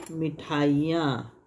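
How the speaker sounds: slow demo. Shown as a demonstration. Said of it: "छोटी ही हो जाएगा".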